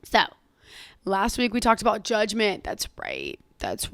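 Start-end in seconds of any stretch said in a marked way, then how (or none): 2.6-3.9 deep voice. Pretend. Very low put-on voice.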